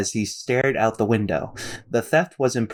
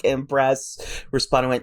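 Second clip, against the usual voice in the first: usual speaking voice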